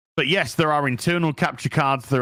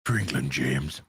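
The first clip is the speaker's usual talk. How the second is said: very deep, softened Yorkshire going posh; low, quiet impression of the Yorkshire actor; Sean Bean; 12 semitones lower, 5 dB quieter than usual. Streamer demonstrating the accent, deep Sean Bean impression. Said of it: "You can't get rid of that".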